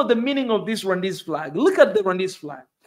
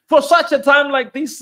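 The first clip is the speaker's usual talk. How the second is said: raised high and loud like a preacher; man, for such a time like this, rising to a shout; fervent preaching peak; 7 semitones higher, 8 dB louder than usual. Real, not acted. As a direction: lift it into a rousing cry. Preacher building to a climax.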